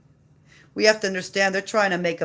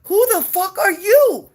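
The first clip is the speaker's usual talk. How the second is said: outraged shout; 10 semitones higher, 7 dB louder than usual. Real, not acted. Angry mother confronting doctors.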